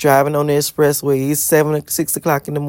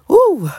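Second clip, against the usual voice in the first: high whoop; 3 dB louder than usual. Real, not acted. Overwhelmed by God's blessing.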